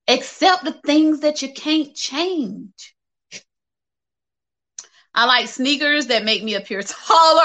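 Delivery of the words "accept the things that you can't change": ordinary talk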